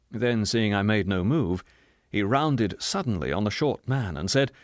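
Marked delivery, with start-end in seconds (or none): none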